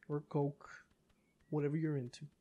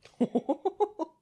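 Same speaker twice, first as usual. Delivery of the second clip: high burst of laughter; 11 semitones higher, 6 dB louder than usual. Real, not acted. Laughing at goose.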